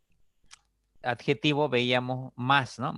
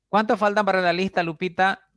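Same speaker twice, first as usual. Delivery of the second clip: higher, louder, quicker classroom question; teacher questioning pupil; 7 semitones higher, 6 dB louder than usual. Acted. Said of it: "Los maestros preguntan". (maestro que pregunta en voz alta a su alumna)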